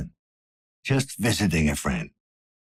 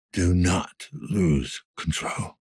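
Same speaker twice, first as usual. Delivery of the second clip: very slow, deliberate self-command; slow measured warning to self; slow self-control; much slower than usual. Acted. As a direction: force each word out slowly. Detective steadying himself.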